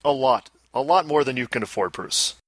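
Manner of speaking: usual speaking voice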